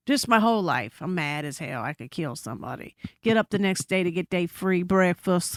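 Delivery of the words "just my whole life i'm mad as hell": ordinary delivery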